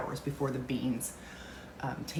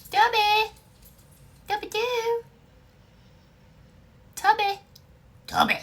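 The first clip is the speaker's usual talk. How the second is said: high loud call to the dog; 9 dB louder than usual. Real, not acted. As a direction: shout the dog's name up high. Calling the second dog.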